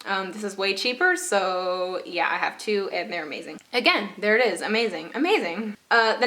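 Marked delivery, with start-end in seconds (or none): none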